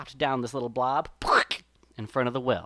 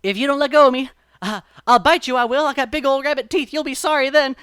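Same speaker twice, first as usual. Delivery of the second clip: higher, louder frantic threat to bite; rabbit threatening; 9 semitones higher, 10 dB louder than usual. A narrator's reading. Stuck Brer Rabbit threatens to bite the sap person, loud. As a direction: bluster through panic. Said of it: "and he says".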